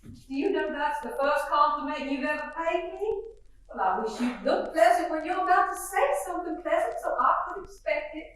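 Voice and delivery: gushing genteel belle, chattering; lightly voiced Southern mother greeting the guest; fluttery Southern mother. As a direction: play the chatty Southern hostess.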